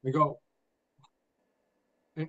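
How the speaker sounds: normal talking voice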